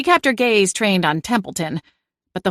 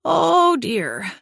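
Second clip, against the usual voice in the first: moaning; 11 semitones higher than usual. A narrator's reading. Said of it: "Checkers moaned".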